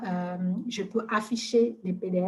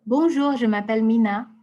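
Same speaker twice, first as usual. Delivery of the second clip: loud, clearly spoken phrase for voice dictation; dictating to the mic; 2 semitones higher, 7 dB louder than usual. Shown as a demonstration. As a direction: speak up clearly so the dictation hears it. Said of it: "il est en train de m'écouter".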